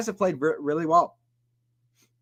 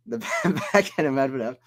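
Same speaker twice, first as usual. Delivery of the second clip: laughing through it. Real, not acted. Laughing at own mistake.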